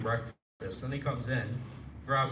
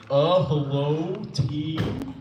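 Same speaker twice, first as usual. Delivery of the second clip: loud, slow, dopey gamer call into a headset; imitating the roommate calling his team, slow and loud; gamer roommate impression; 4 semitones higher, 10 dB louder, much slower than usual. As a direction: drag out a dumb hello to your teammates. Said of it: "you would just hear him be like".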